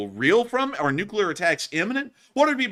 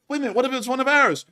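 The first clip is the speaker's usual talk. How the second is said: higher, fast, worried blurt; mock alarm; 11 semitones higher, 4 dB louder, faster than usual. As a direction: rush the doubt out in alarm. Another soldier doubting quickly.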